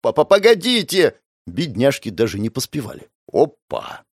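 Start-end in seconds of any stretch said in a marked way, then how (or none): 0.1-1.1 calling out. Acted. Nurses chasing old man.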